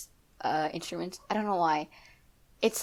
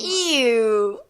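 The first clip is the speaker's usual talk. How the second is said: disgusted; 6 semitones higher, 11 dB louder than usual. Real, not acted. Disgust at electric basses.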